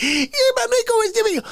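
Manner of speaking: put-on high, fast girly voice; hammed-up valley girl; mock bandana girl. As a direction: squeak it out quickly. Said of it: "She's like".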